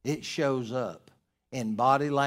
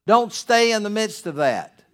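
urgent warning; 7 semitones higher, 9 dB louder than usual. Retold or imitated. Pastor urging an abused wife to leave, loud and urgent. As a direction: order her out of danger. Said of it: "I've always said to a woman".

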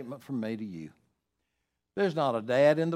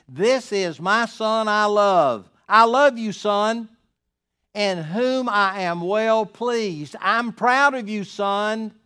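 loud, slow, higher booming fatherly proclamation; voice of God the Father; 6 semitones higher, 10 dB louder, slower than usual. Acted. God speaking from heaven to his beloved Son, loud, slow and booming. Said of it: "This is the Father's way of saying".

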